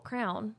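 crayon said as one syllable, "crown"; Indiana pronunciation. Shown as a demonstration. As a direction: say "crown" for crayon, rhyming with town. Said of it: "Yes, right there".

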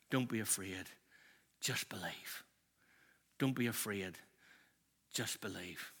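gentle reassurance. Acted. Jesus looking Jairus in the eye, soft reassuring words. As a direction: soothe a grieving father quietly.

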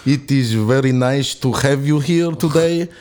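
put-on slow Russian film-villain accent. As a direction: speak slowly like a Russian bad guy. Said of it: "I'll have a go at Russia".

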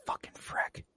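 hushed, very soft aside to yourself; muttered curse. Retold or imitated. Viewer recalling their reaction to Vrek, muttered low-volume curse. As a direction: curse under the breath, barely voiced.

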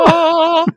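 high happy yelp. Real, not acted. Celebrating the high note.

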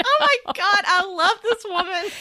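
high, loud, laughing delight. Real, not acted.

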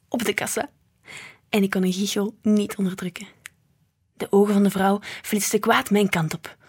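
ordinary delivery, nothing marked

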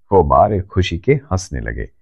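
plain reading voice

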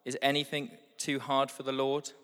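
normal talking voice

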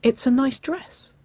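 high, lilting, rising playful tone; teasing innocence. A narrator's reading. Waverley teasing her stunned girlfriend, coy innocence. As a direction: tease with mock innocence, pitched well up. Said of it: "twisted her hips teasingly".

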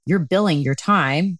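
ordinary delivery

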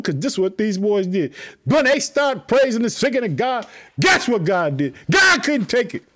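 high, driving sermon delivery; fired-up preaching. Real, not acted. Preacher telling the church how praise moves God, fired up. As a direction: preach it at an excited pitch.

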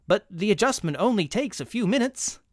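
high, anxious salesman plea; pleading clerk. Acted. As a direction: beg him to stay.

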